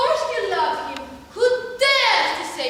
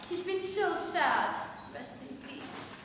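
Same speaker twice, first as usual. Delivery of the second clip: normal talking voice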